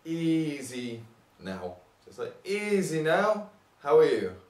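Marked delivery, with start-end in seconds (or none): 0.0-1.8 "easy" stretched out long and slow; long drawn-out EASY. Shown as a demonstration. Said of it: "you have a long". 2.5-4.3 high, stretched "Easy", then a punchy question; long drawn-out EASY. Shown as a demonstration. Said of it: "you have a long".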